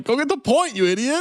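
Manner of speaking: high-pitched imitation of an irritated coach; mock exasperation. Coach scolding a confused fighter, high mock exasperation. Quoting Silver snapping at his fighter.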